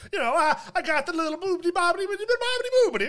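mock Italian Mario. Mario at his intervention, hammed-up Italian accent. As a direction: ham up a cartoon Italian accent. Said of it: "Stereotypical Italian".

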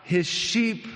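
high, emphatic sermon voice, slowing into long pauses; impassioned preaching. Acted. Preacher calling the congregation up to grace, fervent preaching. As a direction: preach it with rising fervor, stretching key words.

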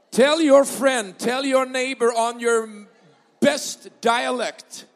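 loud, slow, drawn-out English call; preaching call. Acted. Pastor urging the congregation to talk dialect, loud and slow. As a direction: stretch each word out to the crowd. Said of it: "preacher urging congregation, slow and loud".

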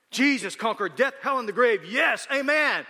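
loud, high, triumphant cry; rousing church cheer. Acted. Churchgoers cheering a phrase they barely understand, excited. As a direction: cheer it like an amen-shouting congregation.

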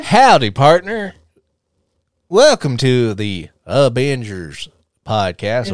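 slow, drawn-out Southern drawl; hammed-up Southern podcast host; Southern cowboy greeting. Mock Southern podcast intro.

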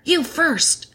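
high-pitched, voiced stage whisper; urgent whisper. A narrator's reading. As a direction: hand it over in a mock whisper. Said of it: "whispered Peter".

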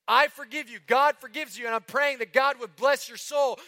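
voicing a weeping mother. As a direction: give the mother's forgiveness through tears. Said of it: "That's what she said".

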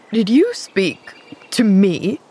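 slow, sharp question in a man's character voice. A narrator's reading. Man asked by a stranger child, sharp voice. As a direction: challenge the child, each word drawn out sharply. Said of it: "in a sharp voice".

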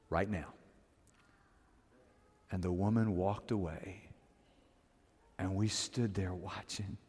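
very soft, low, reverent narration with long pauses; hushed solemn storytelling. Acted. Disciple recounting Jesus healing the woman's daughter, hushed and solemn. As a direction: let each phrase land gently, almost under the breath.

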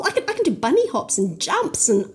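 put-on high, eager girl's voice; bubbling with excitement; excited. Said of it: "she was very excited".

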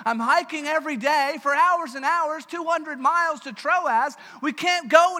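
put-on high, loud whiny complaint of a weary young traveller; Timothy complaining. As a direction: play sore, fed-up Timothy venting. Preacher voices Timothy complaining.

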